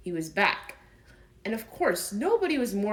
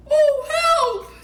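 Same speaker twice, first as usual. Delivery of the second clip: high, loud cry for help as Pooh; little bear panicking, much higher and louder; alarmed cry; 12 dB louder than usual. Acted. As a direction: yelp in alarm, stuck fast. Pooh stuck, cries out.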